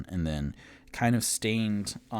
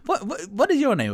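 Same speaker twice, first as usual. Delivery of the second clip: excited high voice; 10 dB louder, faster than usual. Acted. Sudden high loud question.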